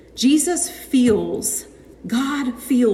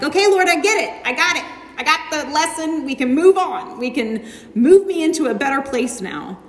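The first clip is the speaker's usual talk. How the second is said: impatient plea; 5 semitones higher, 3 dB louder than usual. Retold or imitated. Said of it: "I'm like".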